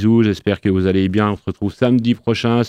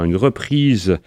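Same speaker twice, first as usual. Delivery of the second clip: ordinary delivery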